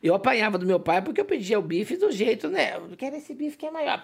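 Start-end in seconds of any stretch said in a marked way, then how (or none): 2.8-4.0 put-on soft, higher childish voice; mock child request. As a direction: ask like a little boy. Child asking for steak.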